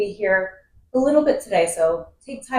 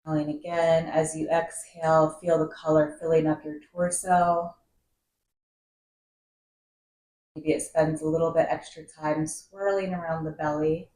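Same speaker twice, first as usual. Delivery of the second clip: guided meditation. Acted. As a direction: guide the breath calmly, drawing out key words and letting phrases settle. Leads a seated breathing meditation.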